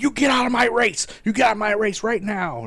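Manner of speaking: high, strained mock shout; angry official yelling. Official chasing runner off his race, furious imitated yell. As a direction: roar like an outraged race official.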